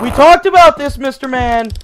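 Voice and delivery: high, loud reprimand; loud telling-off, easing on the name; mock scolding. Scolding a game character.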